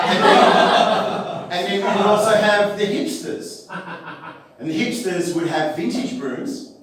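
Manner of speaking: ordinary talk, nothing marked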